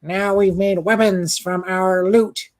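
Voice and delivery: alien voice. Acted. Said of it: "an alien voice for them".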